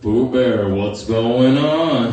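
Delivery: slow, gloomy donkey voice; acted doleful cartoon donkey. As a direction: drag it out glumly. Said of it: "Eeyore walks by".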